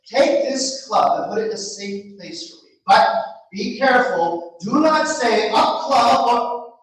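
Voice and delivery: a naive lad handing over his treasure; Antonio's voice. Acted.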